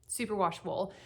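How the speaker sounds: usual speaking voice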